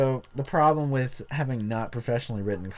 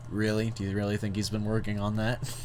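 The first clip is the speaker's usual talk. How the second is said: usual speaking voice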